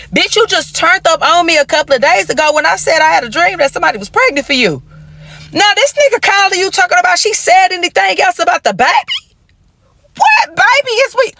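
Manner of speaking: high, pressing questions; outraged questioning. Retold or imitated. Confronting her man about a pregnancy, high and outraged. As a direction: corner him with the baby question.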